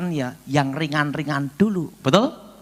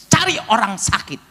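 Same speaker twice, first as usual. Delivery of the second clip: firm command; 6 semitones higher, 4 dB louder than usual. Retold or imitated. God's command, acted out.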